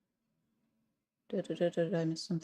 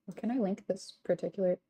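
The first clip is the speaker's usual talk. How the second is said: ordinary talk, nothing marked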